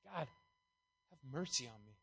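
quietly asking for mercy; humble sinner's plea. Retold or imitated. Tax collector begging God for mercy, soft and slow. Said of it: "It's like".

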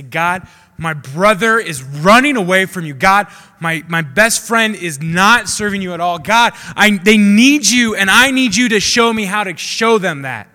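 desperate loud prayer for a brother; earnest loud prayer. Pretend. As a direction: beg God with everything in you. Praying with everything, on knees.

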